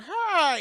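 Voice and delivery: loud, high screech; sudden piercing mock roar; imitated monster cry. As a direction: screech like a kaiju spotting prey. Imitated monster cry on seeing her.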